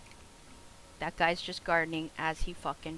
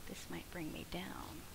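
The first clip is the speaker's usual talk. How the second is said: whispered to himself, much softer; 14 dB quieter than usual. Real, not acted. Gamer whispering to himself over gear weight. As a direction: whisper it as a private thought. Muttering about game equipment load.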